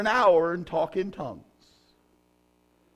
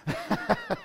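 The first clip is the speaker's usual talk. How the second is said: high laugh. Real, not acted.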